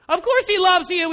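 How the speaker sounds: Of course he loves you, pitched high and loud. Retold or imitated. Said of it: "And he's complaining".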